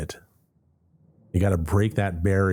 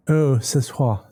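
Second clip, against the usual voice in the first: put-on French accent; 6 semitones higher, slower than usual. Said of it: "Oh, French".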